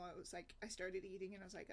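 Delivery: usual speaking voice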